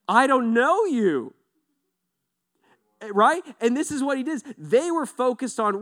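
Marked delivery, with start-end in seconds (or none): 0.1-1.3 loud, slow, high and final; slow loud firm denial; stern rejection. Acted. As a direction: declare it like a verdict. Jesus's rejection, acted.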